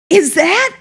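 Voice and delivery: high, loud question of disbelief; astonished. Retold or imitated. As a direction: imitate a student who can hardly believe it. Retold student astonished at car.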